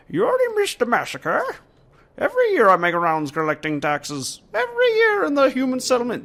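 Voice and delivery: high, overblown warrior voice running away; out-of-control character voice. Acted. Player voicing a tax-collecting warrior, out-of-control voice. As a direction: push the put-on voice high until it gets silly. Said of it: "voice is getting totally out of control".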